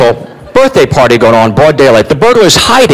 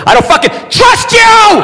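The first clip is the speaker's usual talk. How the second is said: sudden shout. Retold or imitated. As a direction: yell it at your wife through the door. Yelling at wife from chamber.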